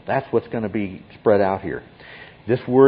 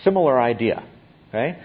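ordinary delivery

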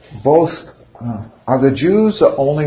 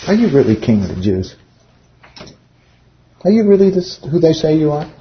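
doubting question; 5 semitones higher, 3 dB louder than usual. Retold or imitated. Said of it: "I think he said it this way".

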